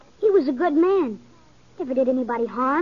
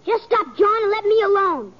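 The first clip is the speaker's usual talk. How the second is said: upset plea; 6 semitones higher, 4 dB louder than usual. Acted. Boy pushes the friend away.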